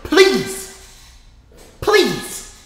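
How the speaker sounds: very high, loud pleading yells; exasperated shouting. Real, not acted. Streamer begging viewers to stop finding the live, exasperated high shouting. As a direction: beg them to stop at full volume.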